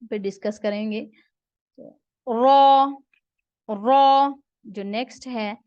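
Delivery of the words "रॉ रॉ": "रॉ" said loud and drawn out, clearly articulated; letter demo. Shown as a demonstration. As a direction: project "रॉ" slowly like a teacher. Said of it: "सीटी की आवाज pronounce करनी है".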